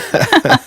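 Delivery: high, amused giggle. Real, not acted. Host giggles at being called a recovering Nilla, high giggle. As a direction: giggle at the teasing.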